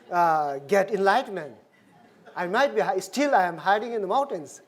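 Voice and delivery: usual speaking voice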